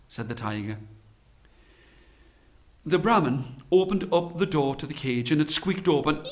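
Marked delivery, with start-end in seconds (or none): none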